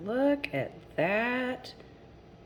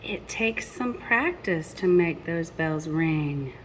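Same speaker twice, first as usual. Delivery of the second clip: lilting rhymed storybook read; reading to children. A narrator's reading. Parent reading a picture book to children, lilting rhyme. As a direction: read the rhymes to the little ones.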